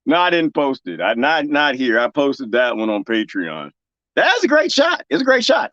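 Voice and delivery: ordinary talk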